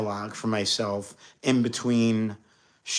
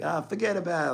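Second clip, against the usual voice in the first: ex imitating his New York "forget about it", raised high; mock New York accent, pitched up; playful New York accent, higher; ex's New York accent; 7 semitones higher, 4 dB quieter than usual. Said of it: "my ex used to be like".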